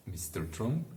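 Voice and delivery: plain reading voice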